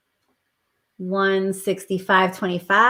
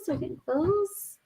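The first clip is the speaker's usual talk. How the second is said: high playful lilt; high teasing singsong; 9 semitones higher, 5 dB quieter than usual. Acted. Repeats line, second take higher.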